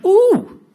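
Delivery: loud, very high gleeful whoop; delighted "ooh". Acted. Celebrating his first stone lost, gleeful high ooh.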